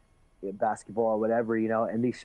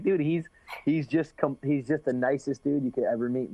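usual speaking voice

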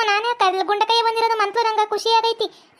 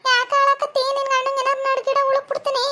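higher, put-on voice of a nagging neighbour woman; shrill scolding village woman; 4 semitones higher than usual. Woman scolding the man for siding with her rival, in a high put-on voice. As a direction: play the fussing aunt, pitched up and complaining. Plays Gundakka arriving worried.

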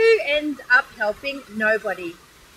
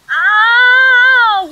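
long, high, loud yelp; loud acted "ow" of pain; pained cry; 9 semitones higher, 9 dB louder than usual. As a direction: howl as plaster is ripped off. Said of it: "he cried".

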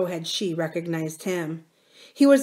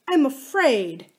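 higher, slow plea of fear; fearful cry; 7 semitones higher, 4 dB louder, slower than usual. A narrator's reading. Sick wife confiding in her husband, mildly afraid. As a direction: draw it out anxiously, higher. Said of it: "she cried".

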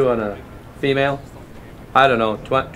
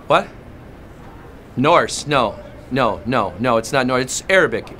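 normal talking voice

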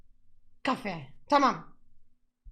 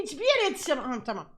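ordinary delivery

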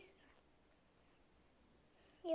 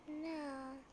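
normal talking voice

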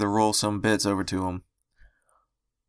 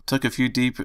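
ordinary delivery, nothing marked

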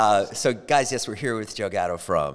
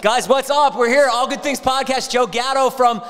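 high, loud podcast announcer welcome; hyped host intro; 9 dB louder than usual. Acted. Host announces comedian guest to viewers, hyped intro. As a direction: announce the guest with big, raised energy.

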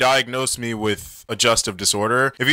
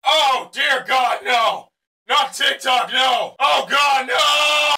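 hammed-up despair; 13 semitones higher, much slower than usual. Creator pretending horror at being on TikTok, hammed-up high wail. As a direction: wail theatrically in fake horror.

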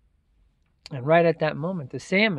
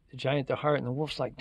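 usual speaking voice

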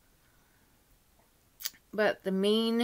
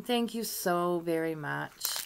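usual speaking voice

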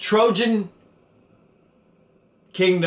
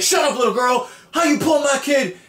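higher, hammed-up outraged father; mock angry dad; 9 semitones higher than usual. Dad yelling at little girl in stands, mock anger. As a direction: snap at the kid, mock fury.

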